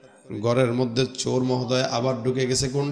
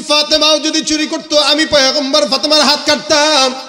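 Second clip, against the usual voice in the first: high, loud, chanting sermon delivery; full-force preaching; 12 semitones higher, 9 dB louder than usual. Acted. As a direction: proclaim it to a big crowd. (উচ্চকণ্ঠে সুরেলা ওয়াজ)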